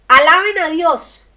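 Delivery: loud, slow, ringing cry of praise; booming exclamation of thanks; exclaimed praise. Retold or imitated. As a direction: shout it like the healed man running back. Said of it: "volvió a Jesús y exclamó".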